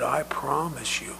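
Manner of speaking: very soft, earnest promise; sincere reassurance. Acted. Paramedic answers the doubting little girl, soft sincere reassurance. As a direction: promise it quietly to a child.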